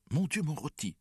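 high-pitched domestic panic; alarmed cry. A narrator's reading. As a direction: cry out as if dinner is burning. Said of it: "s'écria mère en se précipitant". (cri affolé, nettement plus aigu)

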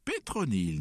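high, loud surprised cry; joyful exclamation. A narrator's reading. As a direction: hail the newcomers with delight. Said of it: "nous exclamâmes-nous".

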